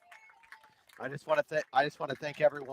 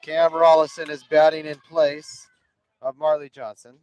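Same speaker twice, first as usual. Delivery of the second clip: play-by-play; 3 semitones higher, 6 dB louder than usual. Acted. Live softball play-by-play.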